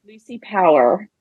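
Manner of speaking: "Lucy Power" with a strong American r on "Power"; American accent. Shown as a demonstration. Host asks guest for her name in American accent. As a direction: give it your best American "Power". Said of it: "another Lucy Power in your American accent".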